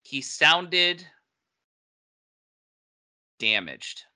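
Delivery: long hesitant pause before "damaged"; careful wording. Real, not acted. Judging a film accent tactfully, careful wording. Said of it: "I gotta word this carefully".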